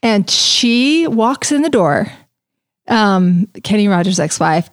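ordinary talk, nothing marked

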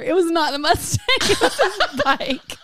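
high, laughing through the words. Real, not acted. The teller laughing as she reveals the smell was his bike. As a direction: crack up mid-sentence.

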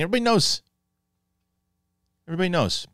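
mock voice, pitched well up; playful high-voiced quote; high-pitched quoted retort. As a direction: repeat it high and knowing. Acting out the answer once more.

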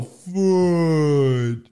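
loud, long-held high exclamation; Christmas Story fudge. Acted. Quoting the movie to show how he felt, drawn-out cry. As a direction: stretch the word out in slow-motion dismay. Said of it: "That's how I feel".

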